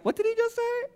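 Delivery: high, hushed shocked question; stunned disbelief. Retold or imitated. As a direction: ask it like you can't believe your ears. Disciples turning to each other, stunned.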